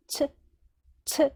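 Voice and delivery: "치" pronounced as one careful ts-syllable; Japanese "tsu" demo. Shown as a demonstration. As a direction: say "치" as a short, isolated Japanese tsu. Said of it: "이렇게 발음을 해야 되거든요".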